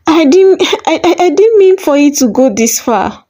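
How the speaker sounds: high, tearful, stammering voice; pleading through tears. A narrator's reading. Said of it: "Tears began streaming down Mama Ijeoma's face".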